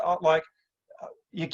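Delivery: usual speaking voice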